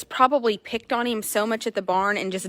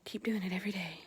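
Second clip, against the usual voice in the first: breathy whispered quote; 3 semitones lower, 16 dB quieter, faster than usual. Retold or imitated. Retelling what they told the horse in a whisper. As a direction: hush it under your breath. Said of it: "We're like".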